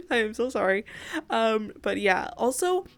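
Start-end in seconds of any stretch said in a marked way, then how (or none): 0.0-0.9 laughing, nearly crying apology. Real, not acted. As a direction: giggle through the apology.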